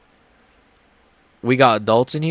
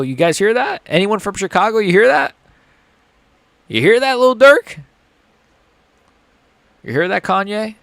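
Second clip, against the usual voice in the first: mock taunt; 7 semitones higher than usual. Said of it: "taunting Chicago after insulting it".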